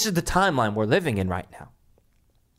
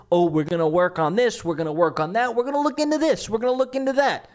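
imitating Trump; 6 semitones higher, faster than usual. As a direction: pitch jumps high, quick and breezy. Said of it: "When Trump says".

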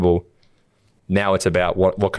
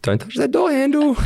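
high mock warning. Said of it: "and you're like".

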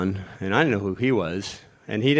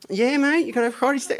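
Aki impression; 14 semitones higher than usual. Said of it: "mimicking Aki".

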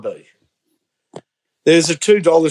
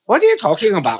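higher, puzzled mock Chinese-accented shopkeeper; 5 semitones higher, faster than usual. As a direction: put on a baffled Chinese shop owner's accent. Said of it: "he said something in Chinese".

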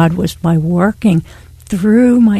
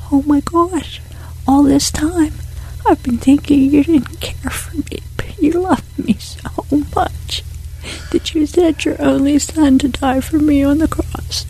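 crying prayer; 6 semitones higher than usual. Real, not acted. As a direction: pour it out to God in tears. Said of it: "I was crying. I said".

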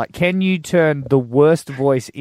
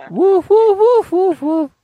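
very high, loud dog barks; squeaky little dog barking; high yappy woofs; 9 dB louder, much slower than usual. Shown as a demonstration. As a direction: yap like a tiny dog, way up high. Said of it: "And higher again".

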